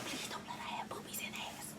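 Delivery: breathy whispered plea; hushed prayer to Jesus. Retold or imitated.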